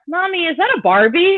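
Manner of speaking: put-on high small-child voice; little son asking mommy about a photo; toddler question. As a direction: ask innocently like a little kid.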